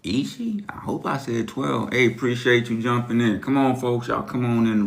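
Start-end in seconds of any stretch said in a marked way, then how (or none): 0.0-1.9 breathy unvoiced aside to himself; whisper. Real, not acted. Live-stream host whispering to himself before starting.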